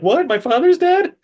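squeaky, hammed-up disbelief; overplayed gasp of surprise in a very high voice; mock shocked. Girl hearing dad is dead.

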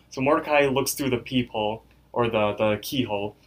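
usual speaking voice

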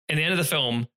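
ordinary talk, nothing marked

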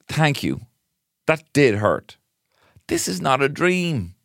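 high, mock-pained realisation; hammed-up disillusionment; hurt dreamer act. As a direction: play dawning letdown in a lifted voice.

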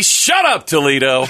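announcer catchphrase. Pretend.

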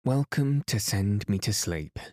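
ordinary delivery

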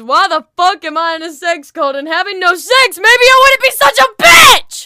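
exasperated comic tirade; angry outburst. Real, not acted. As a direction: blow up, peaking on the last word. Heated rant building to a yell.

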